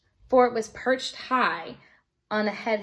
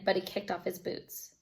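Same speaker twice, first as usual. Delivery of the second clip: usual speaking voice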